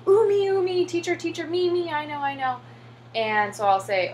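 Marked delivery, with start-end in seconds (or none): 0.1-2.6 high, eager begging to be picked; mimicking excited students shouting to be called on; eager kids. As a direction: imitate kids clamoring to answer, much higher.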